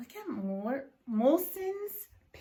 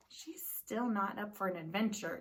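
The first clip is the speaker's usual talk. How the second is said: usual speaking voice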